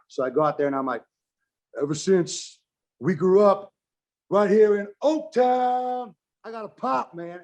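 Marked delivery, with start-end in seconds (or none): 1.8-7.4 hammed-up promo, higher, louder and slow; wrestling promo. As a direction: belt it out to eighteen thousand fans. Retelling his live wrestling promo.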